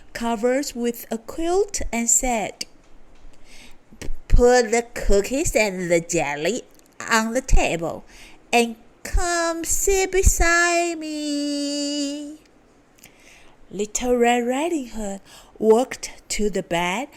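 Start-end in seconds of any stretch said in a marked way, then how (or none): 4.3-12.4 sly wolf. A narrator's reading. Wolf luring girl.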